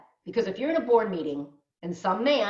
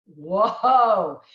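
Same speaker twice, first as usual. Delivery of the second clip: loud, drawn-out reaction of astonishment; amazed whoa; 7 dB louder than usual. Acted. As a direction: let the realization land in one big whoa. Acts out her amazed reaction.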